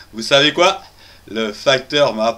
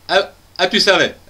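normal talking voice

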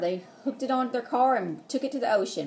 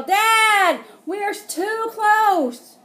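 high, loud alarmed call to Dad; drawn-out worried cry; yell; 9 semitones higher, 6 dB louder, much slower than usual. Acted. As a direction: shout a warning at Dad. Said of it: "I yell".

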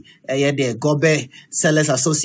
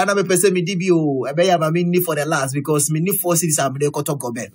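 ordinary talk, nothing marked

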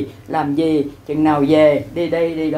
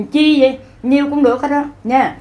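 exclaiming; 8 semitones higher than usual. Acted. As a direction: raise the voice, coaxing the wife to accept.

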